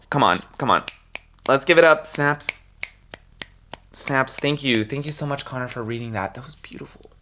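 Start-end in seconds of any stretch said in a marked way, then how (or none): 2.1-7.1 mock soft praise. Mock-praising himself as Connor.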